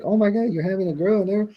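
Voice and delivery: high-pitched imitated amazement; retold astonishment. Inmate learning brother's wife expects a girl, astonished. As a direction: let the voice jump up as the news lands. Retells learning of his niece.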